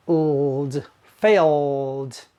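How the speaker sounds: usual speaking voice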